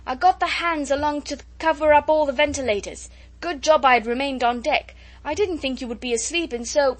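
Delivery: loud, high report over the wind. A narrator's reading. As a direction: call it out as if quarrelling. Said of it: "converse with raised voices as people quarrel".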